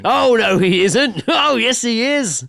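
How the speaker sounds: high loud panto call and answer; panto crowd callback. Pretend. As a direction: shout it like a pantomime audience.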